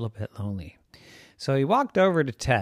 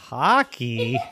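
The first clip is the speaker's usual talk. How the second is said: incredulous laugh at the name. Acted. Salim laughs at the dog wanting to be Hockey, laughing. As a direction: burst out laughing at it.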